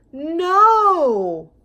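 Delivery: loud, high sharp shout. Acted. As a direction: stop the dog with one loud word. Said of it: "the man next door said".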